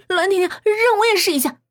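high, loud, insistent plea; excited girl calling out; eager demand. A narrator's reading. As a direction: clamour for a turn. Roommates grab for the hairclip.

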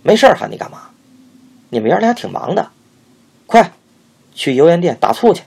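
high, loud, fast impatient scold; snappish scolding. A narrator's reading. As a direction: snap at the boy with sarcasm. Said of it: "师娘没好气地说".

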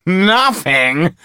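high, loud mocking thug. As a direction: scoff at the actor. Thug laughs off empty threat.

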